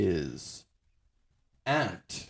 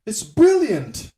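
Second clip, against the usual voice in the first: high, loud, slow posh British "brilliant"; hammy British praise; mock British; 14 semitones higher, 9 dB louder, slower than usual. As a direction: drawl it like a proper Englishman. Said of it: "British people sound so much smarter".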